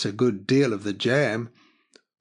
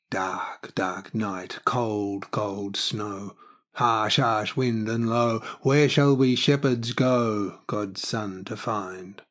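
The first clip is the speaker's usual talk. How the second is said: verse recitation; much slower than usual. A narrator's reading. Verse recited amid the narration.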